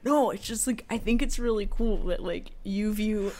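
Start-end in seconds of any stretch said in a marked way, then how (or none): none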